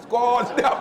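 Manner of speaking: high, loud mock drill command; giving orders. Imitating the warrant officer giving orders, shouted command. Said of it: "Macam dia bagi arahan tu".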